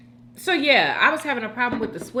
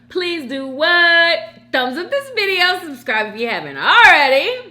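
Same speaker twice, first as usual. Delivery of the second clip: energetic outro; 9 semitones higher, 9 dB louder than usual. Acted. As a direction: sell the subscribe ask. Outro call to subscribe.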